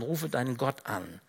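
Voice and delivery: ordinary talk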